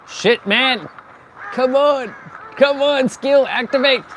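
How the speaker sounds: high panicked yelling; frantic, loud, desperate cries. Real, not acted. Being chased, panicking.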